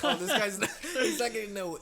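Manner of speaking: loud, high, breaking into laughter; can barely get the words out for laughing; laughing mid-quote. Retold or imitated. Mocking the guy beside him while laughing loudly.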